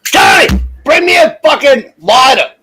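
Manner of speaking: loud high shout to another room; yelling through the house. Real, not acted. Host yelling to Johnny through the house, loud and high. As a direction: bellow through three walls. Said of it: "That's just how I talk around the household".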